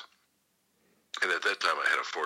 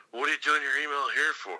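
interrogating cop; 11 semitones higher, 4 dB louder than usual. Retold or imitated.

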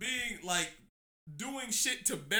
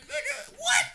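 high laughing outburst; laughing disbelief; 6 dB louder than usual. Retold or imitated. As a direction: squeal it through laughter, incredulous. Mock reaction, disbelief.

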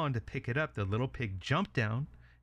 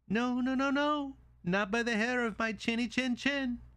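high-pitched little pig voice; defiant little pig; 13 semitones higher than usual. Acted. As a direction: squeal out the refusal as a tiny pig. Pig refuses the wolf.

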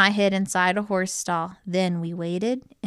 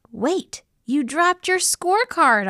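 high, raised-voice call; shouting; 10 semitones higher, 4 dB louder than usual. A narrator's reading. Calling after the colonel flapping away, high acted shout. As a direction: call out urgently after someone. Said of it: "I shouted".

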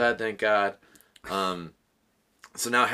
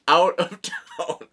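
high, loud laughing; 9 semitones higher, 8 dB louder than usual. Retold or imitated. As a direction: laugh through the directions. Staff giving surprising distance.

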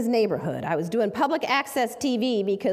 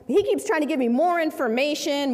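ordinary talk, nothing marked

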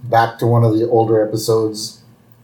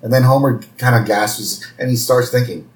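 ordinary delivery

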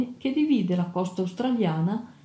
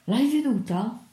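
high, eager questioning; excited, insistent question; urgent question; 4 semitones higher than usual. A narrator's reading. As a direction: demand it urgently, pitch lifted. Mad officer urgently questions narrator.

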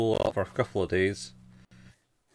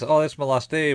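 eager proposal; 6 semitones higher, 6 dB louder than usual. Acted. Acted suggestion of an outing.

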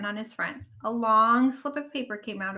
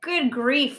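loud, higher-pitched fuss; exasperated fussing; 4 semitones higher, 7 dB louder than usual. A narrator's reading. Rabbit is fed up with the frog jokes, fussing. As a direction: throw it out in exasperation. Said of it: "fussed Rabbit".

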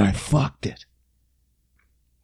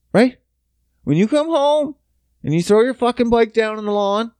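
usual speaking voice

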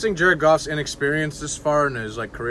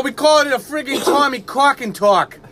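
put-on Boston accent; 12 semitones higher, 6 dB louder than usual. Boston fan joking bit.

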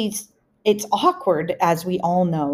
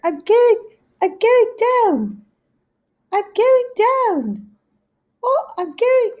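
very high, drawn-out mock wail; high-pitched comic cry of alarm; imitating mother going down; 5 dB louder, slower than usual. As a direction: wail it high and slow, like someone slowly sinking. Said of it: "and she's, I hear".

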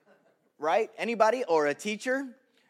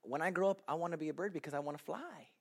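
put-on softer earnest child's sentence; 3 semitones lower, 11 dB quieter, faster than usual. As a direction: read it like a shy kid. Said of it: "my sentence was this".

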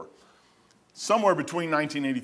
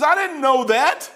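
excited; 12 semitones higher, 6 dB louder, slower than usual. Retold or imitated. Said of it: "She was excited".

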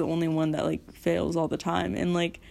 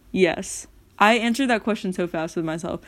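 ordinary talk

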